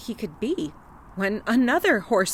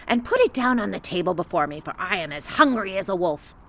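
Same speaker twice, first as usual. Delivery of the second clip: put-on old witch voice; faster than usual. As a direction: order it like a hungry witch. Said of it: "said the witch".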